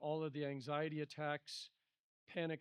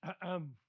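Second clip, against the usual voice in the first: pointed "ahem"; mock attention-getting cough; imitated throat-clearing; 2 semitones higher than usual. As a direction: clear the throat to announce yourself.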